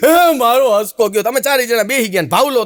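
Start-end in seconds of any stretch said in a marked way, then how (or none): 0.1-1.2 a mock sob stretched over every word; mock wailing mother. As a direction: wail for her dead son like a grieving village mother.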